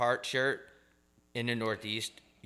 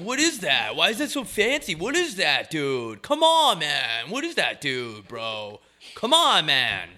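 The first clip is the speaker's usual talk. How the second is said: high, loud pleading disbelief; hammed-up baffled dude, voice leaping up; confused mock outrage; 6 semitones higher, 5 dB louder than usual. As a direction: heckle a friend in exaggerated dismay. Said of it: "They got all confused".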